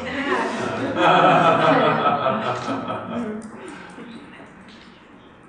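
long, loud real laugh; burst of laughter. Real, not acted. Client laughing at therapist's remark, loud burst of laughter. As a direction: crack up helplessly, then tail off.

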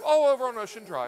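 high, playful show-off answer; mock boasting. Host pretending he lives on Ocean Drive, high-pitched. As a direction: toss off the address with pretend pride. Acts out his dream reply.

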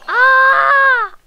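mock cry. Gamer yelping as the ragdoll crashes, mock pain. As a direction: yelp as the crash hits.

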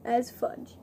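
ordinary talk

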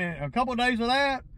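ordinary talk, nothing marked

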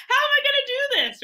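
high-pitched laughing desperation; mock panic. Small founder facing a giant competitor, high mock panic. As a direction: pitch it way up, comically frantic. Retold panic, running out of money.

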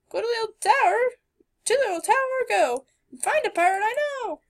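high sing-song rhyme; high riddling voice. Acted. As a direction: chant the riddle. Voicing talking tree riddle.

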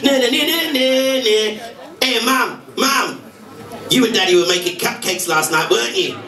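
put-on high, teasing child chant; a little boy taunting his mum; singsong little boy. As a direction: tease mum like a cheeky kid. Little boy voiced in joke.

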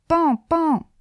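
Pan, pan lancé plus haut, comme des coups secs; coups imités à la porte. Acted. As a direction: imiter le bruit du heurtoir. (le curé imite ses coups à la porte d'argent)

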